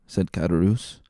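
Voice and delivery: usual speaking voice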